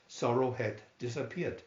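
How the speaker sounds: ordinary delivery, nothing marked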